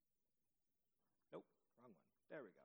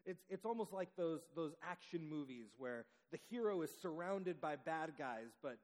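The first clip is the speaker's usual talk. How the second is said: usual speaking voice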